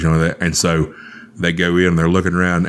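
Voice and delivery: normal talking voice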